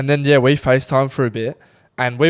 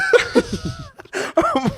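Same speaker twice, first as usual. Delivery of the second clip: long high squealing laugh. Real, not acted. Laughing with friend about drunken night, long high laugh. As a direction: crack up completely.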